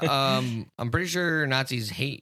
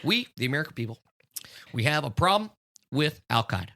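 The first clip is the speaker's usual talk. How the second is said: measured Obama cadence with pauses; Obama-style address; Obama impression; faster than usual. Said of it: "you got the cadence down".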